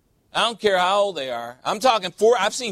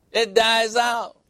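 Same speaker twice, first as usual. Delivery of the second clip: normal talking voice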